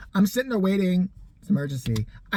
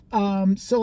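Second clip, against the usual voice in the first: ordinary talk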